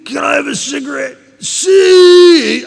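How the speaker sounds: high, loud hammed-up drunk ABCs; drunk recital. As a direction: play the sloppy drunk at the sobriety test, pitch climbing. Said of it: "here's how I did 'em".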